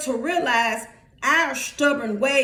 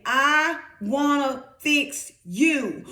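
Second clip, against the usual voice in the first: slow, deliberate preacher's quote; spaced-out, emphatic pronouncement; God speaking; slower than usual. Acted. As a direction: draw out each word with weight.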